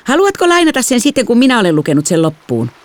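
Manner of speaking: put-on high, chirpy child's voice; lightly voiced little sister. A narrator's reading. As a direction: play the oblivious younger girl.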